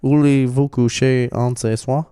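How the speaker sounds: put-on French accent. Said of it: "trying to speak French".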